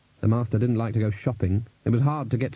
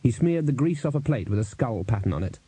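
ordinary delivery

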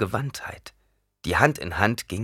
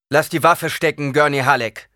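commanding Fremen voice; 8 semitones higher, 8 dB louder than usual. Acted. Fremen confronts Gurney.

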